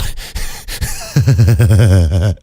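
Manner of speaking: real fit of laughter with a snort; helpless laugh ending in a snort. Real, not acted. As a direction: crack up until a snort slips out. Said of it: "ah, snort count".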